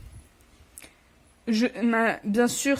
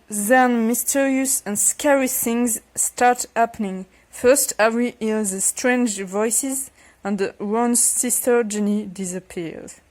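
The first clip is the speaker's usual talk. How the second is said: halting English in a French accent; attempted English accent; slower than usual. Real, not acted. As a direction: read English slowly through a French accent. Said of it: "avec un accent anglais".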